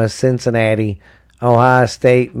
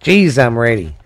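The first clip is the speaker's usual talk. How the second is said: usual speaking voice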